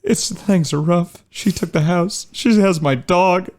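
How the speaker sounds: high, put-on woeful voice; whining divorced boss. Crime boss declining over his messy divorce, mock-pitiful whine. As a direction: plead feebly, high and hammed-up.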